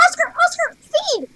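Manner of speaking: high, urgent cry of the name; panicked call to the pup; gasping, high-pitched alarm. Real, not acted. Gasp, puppy nearly dies.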